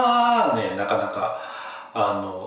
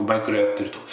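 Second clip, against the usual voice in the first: ordinary talk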